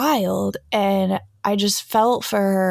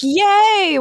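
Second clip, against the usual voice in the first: put-on much higher, louder girlish cheer; 11 semitones higher, 6 dB louder than usual. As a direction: cheer for a friend's good news. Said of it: "we were like".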